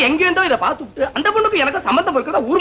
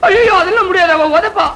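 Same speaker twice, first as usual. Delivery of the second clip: louder, higher panicked protest; alarmed refusal; 4 semitones higher, 5 dB louder than usual. Acted. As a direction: back away in fright, loudly.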